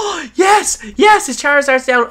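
high shout of delight; excited cheer. Real, not acted. Gamer cheering as the rival's Charizard goes down, shouting. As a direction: yell it out as the win lands.

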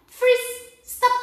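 high loud freeze call. Shown as a demonstration. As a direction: snap everyone into statues. (grito de stop)

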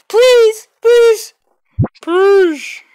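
loud, high, drawn-out begging; urgent high-pitched plea; high pleading. Real, not acted. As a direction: plead in a high voice, one word at a time. Begging the game for luck.

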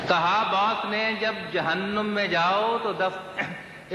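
slow, drawn-out mushaira line; unhurried Urdu verse delivery; poetry recitation. Acted. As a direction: declaim the verse slowly for the audience. Repeats verse line on stage.

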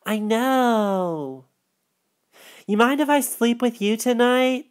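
put-on pleading girl. Darcy voiced, small and pleading.

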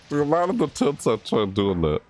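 ordinary talk, nothing marked

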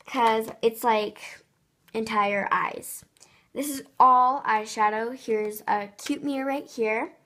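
loud, eager show-off of eyeshadow; gushing makeover reveal. Real, not acted. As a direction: gush over the eyeshadow stash. Showing off eyeshadow drawer excitedly.

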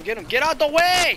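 loud, high frantic shout; urgent yell. Real, not acted. Gamer urges on the attack, urgent yell. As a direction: yell to clear the path. Urging during live gameplay.

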